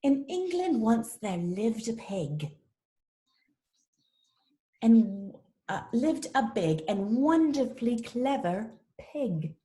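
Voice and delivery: storybook reading in a British accent. Shown as a demonstration. Teacher reads the pig story to the class, British accent. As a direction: put on a British accent for the tale. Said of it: "if I read it in a British accent".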